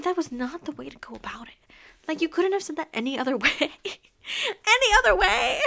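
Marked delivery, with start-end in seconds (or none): 2.1-5.6 high, loud, laughing disbelief; laughing outrage. Real, not acted. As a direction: crack up while protesting.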